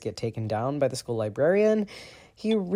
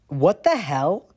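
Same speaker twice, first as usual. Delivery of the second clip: annoyed; 5 semitones higher, 6 dB louder, slower than usual. A narrator's reading. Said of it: "a pissed-off Sirius marching toward them".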